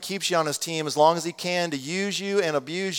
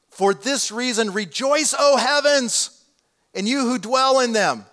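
high, drawn-out scripture proclamation; exultant proclamation; 7 semitones higher, 4 dB louder, slower than usual. A narrator's reading. Preacher reading heaven's call to rejoice, exultant proclamation. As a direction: proclaim it slowly, pitched high.

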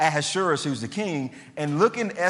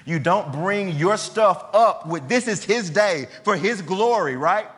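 ordinary delivery

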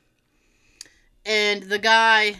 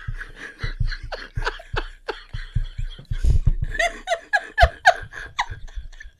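high breathless laugh. Real, not acted. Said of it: "long laugh".

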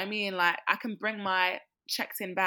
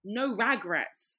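drawn-out motto; 3 semitones higher, much slower than usual. Acted. Echoing friend's motto, drawn out.

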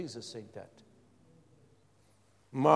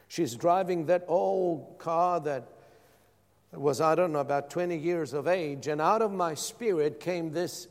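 ordinary talk

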